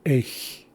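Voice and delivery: eich with a breathy h, not a ks; Haisla x sound demo. Shown as a demonstration. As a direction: say eich ending in a hiss, no k. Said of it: "That does not say aches, that says".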